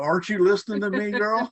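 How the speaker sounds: high, fast, mock exasperation; exasperated neighbor. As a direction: play the fed-up neighbor scolding her. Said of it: "He must have thought".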